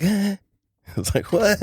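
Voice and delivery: high, startled little yelp. Retold or imitated. Boy hears a voice in his room, startled. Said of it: "It kinda startled me".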